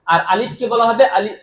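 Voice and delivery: usual speaking voice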